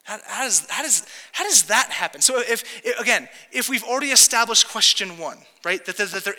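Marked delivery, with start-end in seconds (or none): none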